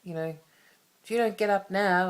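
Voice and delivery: retelling the morning yell at her son, a mock scold; mock stern yell. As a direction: bark the wake-up threat at him. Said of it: "storm in and go".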